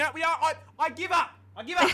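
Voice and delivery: high, exasperated giving up. Real, not acted. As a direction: throw up your hands in despair. Exasperated at neurotoxin again.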